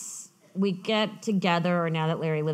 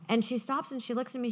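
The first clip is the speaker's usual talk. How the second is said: normal talking voice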